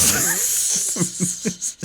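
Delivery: airy amused laugh; breathy laugh. Real, not acted. Laugh after I guess.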